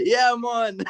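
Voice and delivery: light Jamaican patois, laughing; Jamaican accent. Pretend. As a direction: toss off a Jamaican "yeah man". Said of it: "can you give us your Jamaican accent".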